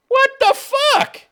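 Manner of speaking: high, loud, slow outraged "what the fuck". Real, not acted. Reacting to the wife's salary demand, high slow outrage. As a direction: stretch it out in disbelief.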